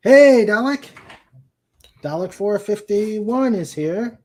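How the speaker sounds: high, loud, delighted call-out to a new arrival; excited greeting. Real, not acted. Host hailing a viewer who just joined, excited and loud.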